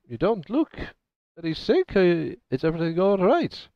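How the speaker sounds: put-on high, lightly German-accented concern; a doctor's mild German worry, slightly Scottish; doctor asking the patient in a light German accent. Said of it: "In his not Scottish German accent".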